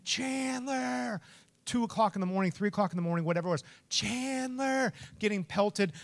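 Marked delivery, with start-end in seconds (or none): 0.1-1.2 high, drawn-out call, held back in volume; mock scream. Owner searching for his dog at night, mock scream. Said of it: "I'm just screaming". 3.9-4.9 high, long-held call without real volume; mock scream. Owner pelted by hail calling his dog, mock scream. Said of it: "I'm just screaming".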